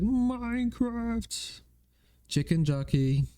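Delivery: drawn-out, high-pitched meme quote; catchphrase quote. Acted. Streamer blurts game catchphrases, put-on high voice.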